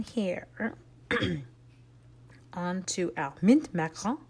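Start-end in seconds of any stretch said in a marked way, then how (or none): none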